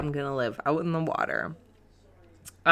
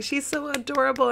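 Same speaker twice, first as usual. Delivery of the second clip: high-pitched adoring coo; mock gushing; 9 semitones higher than usual. Host replaying her reaction to Courtney, high gushing coo. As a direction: coo over someone adorable.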